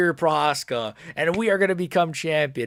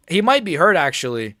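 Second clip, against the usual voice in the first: ordinary talk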